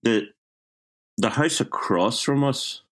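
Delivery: normal talking voice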